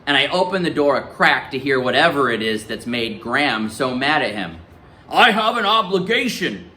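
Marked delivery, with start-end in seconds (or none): 5.1-6.7 lightly voiced grandfather. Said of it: "Grim's voice isn't nearly as loud".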